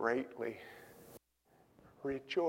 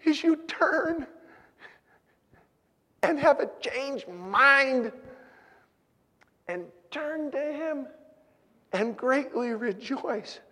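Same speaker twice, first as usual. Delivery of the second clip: high, ringing preacher's call; full-voiced sermon peak; fervent preaching; 14 semitones higher, 6 dB louder than usual. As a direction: proclaim it to the congregation.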